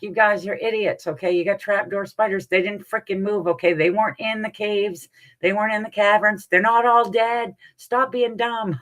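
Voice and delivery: high-pitched, exasperated retold rant; exasperated telling-off. Telling everybody who liked the movie off, exasperated.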